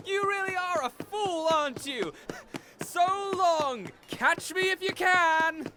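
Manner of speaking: a cocky, high-pitched taunt; gleeful taunting. Acted. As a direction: tease him as you run off. Taunting guard while running off.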